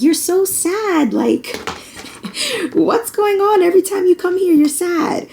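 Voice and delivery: high, mock-puzzled therapist voice; therapist's quote. Mocking her therapist to the viewers, high and puzzled. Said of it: "the therapist used to be like".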